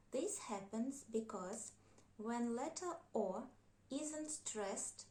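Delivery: usual speaking voice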